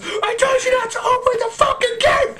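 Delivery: high-pitched mock yell; mid-yell. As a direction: holler it like a furious film hero, hammed up. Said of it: "be mid yell".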